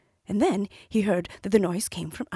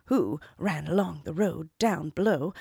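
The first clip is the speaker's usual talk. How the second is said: usual speaking voice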